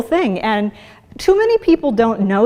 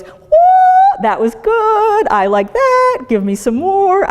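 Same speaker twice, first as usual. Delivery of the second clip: high, loud, delighted brain voice, easing down as it goes; a thrilled brain begging for another hit; brain craving more; 11 semitones higher, 4 dB louder, slower than usual. Retold or imitated. Brain hooked on sugar, gushing with delight. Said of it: "your brain goes".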